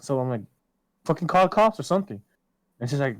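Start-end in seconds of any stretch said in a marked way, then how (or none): 1.1-2.2 higher, quick, exasperated retold advice; retold urging. Urging girlfriend after she was hit, exasperated. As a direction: urge it fast, pitch jumping up.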